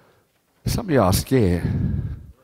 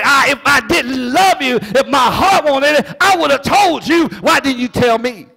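high, loud voice of God pleading; God voiced in a high, loud sermon plea; preached plea; 13 semitones higher, 5 dB louder than usual. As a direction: cry out God's hurt in full preaching.

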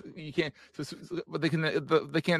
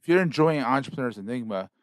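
slightly louder announcer-style promo read; podcast outro read; 4 dB louder than usual. Acted. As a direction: sell the network like a closing plug. Host reads podcast outro promo.

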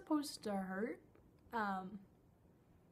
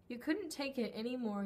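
usual speaking voice

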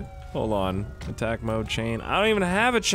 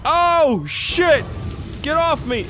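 veel hoger en luider, geschrokken; alarmed yelling; 13 semitones higher, 6 dB louder, much slower than usual. Real, not acted. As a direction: schreeuw het uit als hij aangevallen wordt. Cheering then attacked, yelling.

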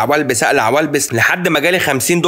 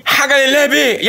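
begging beggar; 11 semitones higher than usual. Retold or imitated. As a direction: beg like a street beggar clinging to the car window. Beggar pleading at the window.

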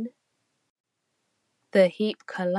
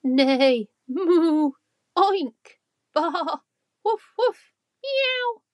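loud, ever higher animal noises; deafening animal racket; 9 semitones higher, 5 dB louder, slower than usual. Acted. Farm animals waking the farmer with a loud racket. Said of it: "let out a deafening sound".

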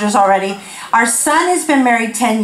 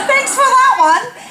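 much higher, sarcastic thanks; mock gratitude; 13 semitones higher than usual. Friend receiving the unwelcome verse, mock gratitude. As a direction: thank them with comic insincerity.

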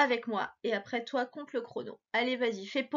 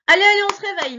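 much louder, higher call; loud, high "on se réveille"; wake-up call; 10 semitones higher, 12 dB louder, much faster than usual. Real, not acted. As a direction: rouse a sleepy pupil. Waking up the pupil.

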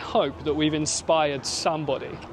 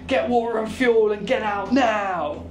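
raised, slow, emphatic alarm; urgent mock warning; 6 semitones higher, slower than usual. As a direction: act out an urgent evacuation order. Acting out alarmist hurricane warnings.

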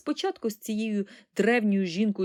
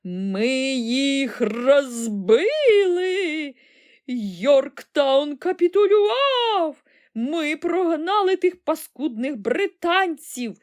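high, drawn-out triumphant yell; loud wailing battle cry; bellowing old woman; 7 semitones higher, 7 dB louder, much slower than usual. A narrator's reading. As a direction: holler the victory news, stretching every word. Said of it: "сіла на ліжку і заволала".

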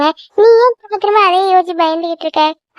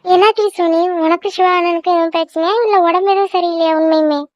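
normal talking voice

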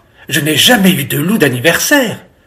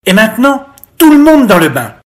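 louder, higher parental order; commanding father; 6 semitones higher, 5 dB louder, faster than usual. A narrator's reading. Father ordering children into the bath, commanding. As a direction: send everyone off briskly.

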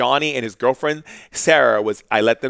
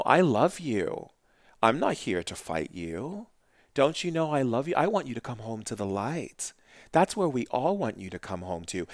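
tender reassurance; 6 dB quieter than usual. Retold or imitated. Shaman soothing the hostile spirit, soft and tender. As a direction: coax the spirit home gently.

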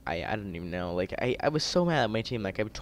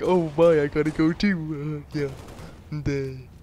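high, loud, airy mock of a quitting teammate; 7 semitones higher, 7 dB louder, much slower than usual. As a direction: toss off a breezy fake goodbye, much higher and louder. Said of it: "my teammate's like".

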